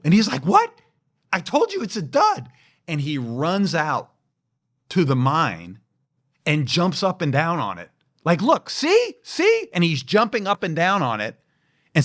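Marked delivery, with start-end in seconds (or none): 0.4-2.5 high, exasperated protest; indignant sergeant. Retold or imitated. Sergeant protesting to his commander, high indignant voice. 8.4-9.7 very high, loud proving it; triumphant sergeant. Retold or imitated. Sergeant jumping on the mine, very high loud triumph.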